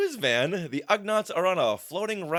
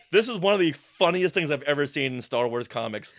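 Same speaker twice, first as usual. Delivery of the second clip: ordinary talk, nothing marked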